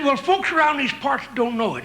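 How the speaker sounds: a hint of a grizzled ranch hand; old Western cowboy. Old cowboy reminiscing to his pal.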